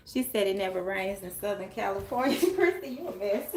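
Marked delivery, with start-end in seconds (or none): 2.4-3.6 laughing. Real, not acted. Laughing at viewer comment.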